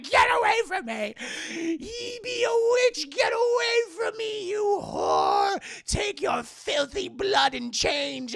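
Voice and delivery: high, loud, drawn-out shrieking; hammed-up furious screaming. Mother drives Anna away, hammed-up shrieked curse.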